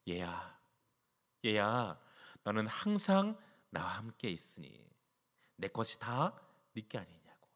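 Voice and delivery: softly and gently, a loving father to his son; tender father. Acted. Preacher voicing the father tenderly to the elder son. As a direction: soothe him like a father calling his child. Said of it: "아버지가 이르되".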